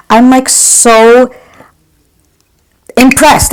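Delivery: thrilled by how good the bronzer is; gushing excitement. Real, not acted. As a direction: drag out the words in real delight. Gushing over a drugstore bronzer.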